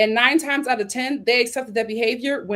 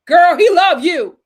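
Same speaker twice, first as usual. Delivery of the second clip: much louder, slow, emphatic; excited; 8 semitones higher, 11 dB louder, slower than usual. Real, not acted. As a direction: hype her up, big and slow. Excited address to the audience.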